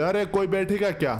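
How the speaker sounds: ordinary talk, nothing marked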